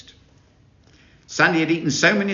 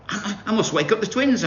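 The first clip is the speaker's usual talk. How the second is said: mild excited shout; 8 semitones higher than usual. A narrator's reading. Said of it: "I shouted".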